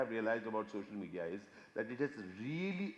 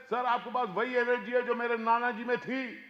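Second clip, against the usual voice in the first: excited fan; 8 semitones higher, 5 dB louder, much faster than usual. Retold or imitated.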